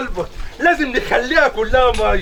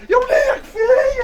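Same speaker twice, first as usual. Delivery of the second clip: high crying shout. Acted. Husband cries out being beaten.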